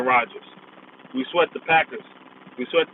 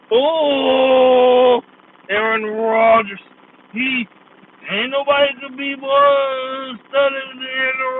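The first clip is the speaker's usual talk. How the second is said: high, loud, slow swooning voice over Aaron Rodgers; hammed-up Chris Cousins gushing, high and slow; mocking fanboy commentator; 9 semitones higher, 5 dB louder, much slower than usual. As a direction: gush like a starstruck TV analyst, dragging each name out. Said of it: "And that's how he was talking".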